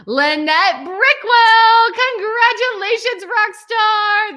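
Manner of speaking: high, loud, slowly stretched name; excited announcement. Real, not acted. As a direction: hype up the winner.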